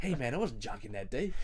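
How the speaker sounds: put-on drawled accent, mock-protesting. Podcaster mimicking the drummer's protest to a co-host, in a silly put-on voice. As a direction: do a daft impression of a protesting bandmate. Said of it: "and [name] goes".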